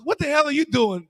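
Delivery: a hint of an incredulous coach; mock outraged coach. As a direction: confront the latecomer. Coach confronting late player.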